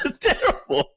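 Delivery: said through a high laugh; laughing while trying to say it. Real, not acted. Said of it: "I can't even say it without laughing".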